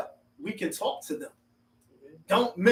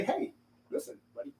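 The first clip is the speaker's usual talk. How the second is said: soft, hushed and unhurried, easing into it; calm gentle correction; 7 dB quieter than usual. Pretend. Telling a friend he was wrong, in a soft, calm voice. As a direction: breathe it out gently, like sparing a buddy's feelings. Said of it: "How do I calmly say".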